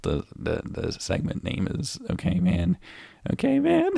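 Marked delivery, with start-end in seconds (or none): 3.3-3.9 "man" squeaked very high with a rise; mock squeaky voice. Mocking his buddy's okay man, very high squeak. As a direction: parrot him in a squeaky voice. Repeating partner's line mockingly.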